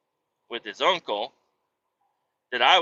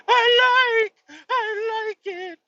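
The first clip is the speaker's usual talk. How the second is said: put-on much higher, slow, squeaky little-boy answer; tiny kid voice; 4 dB louder, much slower than usual. Dad imitating his son answering the video, earnest. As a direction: say it like an earnest toddler.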